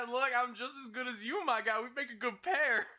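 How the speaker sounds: loud, high, over-keen pitch; hammed-up eager buddy voice; eager showing off. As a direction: pipe up eagerly to win them over. Obi-Wan eagerly courting Cad's approval.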